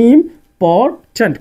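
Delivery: Important stretched slowly into three separate beats; syllable by syllable. Shown as a demonstration. Teacher showing how the word divides into syllables, important in three slow beats. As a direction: break important into three parts with pauses. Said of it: "তিন syllable করে পড়তেছি".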